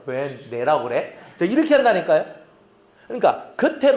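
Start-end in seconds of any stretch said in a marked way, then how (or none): 0.1-1.2 low, slow resentful aside; inner grumble. Acted. Member privately resenting the blame, grumbling low and slow. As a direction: drop low and grumble the unspoken thought. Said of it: "근데 속으로는".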